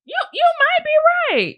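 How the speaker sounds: very high, drawn-out admission; mock concession. Speaker conceding to partner's calm feedback, mock squeaky. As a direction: squeak it out slowly, stretching the words.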